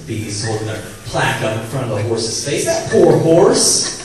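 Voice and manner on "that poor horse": drawn-out, loud, fake sorrowful; milk the pity, slow and loud; hammed-up sympathy dragged out; mock pity. Mocking jockey's fake sympathy.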